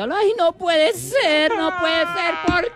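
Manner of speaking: very high, shouted mock sobbing; overacted widow howling over the coffin; hammed-up wailing. Mother-in-law weeps over her son's coffin, hammed-up sobbing shout. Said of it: "ella lloraba en el acto".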